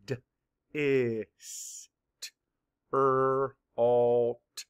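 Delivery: D, I, S, T, R, A, L, T each said as a separate sound; segmented sounds of distraught; sounds mapped apart. Shown as a demonstration. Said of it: "Map the sounds".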